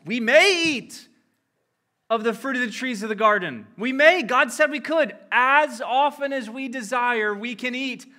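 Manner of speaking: Eve's reply voiced high and loud, insistent. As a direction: Eve protesting to the serpent, loud and high. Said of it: "And so she responds".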